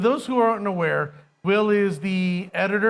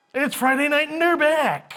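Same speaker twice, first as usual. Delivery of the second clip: announcer voice; 7 semitones higher than usual. Pretend. Mock announcer opening the show.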